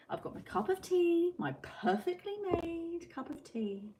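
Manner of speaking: a hammed-up proper tea-drinker; prim and pleased. Real, not acted. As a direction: sound delighted with yourself. Pleased about her tea.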